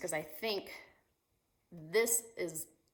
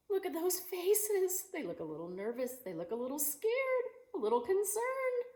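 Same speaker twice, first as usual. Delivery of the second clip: mock concern; 12 semitones higher than usual. Acting worried for kids.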